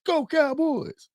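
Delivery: high, slow, sing-song cheer; cheerleader. Pretend. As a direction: chant it like a cheerleader. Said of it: "screaming like a cheerleader".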